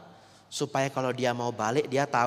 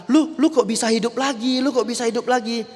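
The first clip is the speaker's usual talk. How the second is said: much higher, louder astonished cry; shocked disbelief; 9 semitones higher, 5 dB louder than usual. Acted. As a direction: gasp it as if the dead man just sat up. Orang kaget suami bangun lagi. (kaget tak percaya, suara jauh lebih tinggi)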